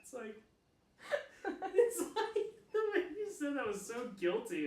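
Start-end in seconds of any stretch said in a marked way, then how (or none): 1.4-4.7 high, loud words through laughter; laughing tease. Real, not acted. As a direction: tease them while cracking up. Cracking up while teasing the speaker.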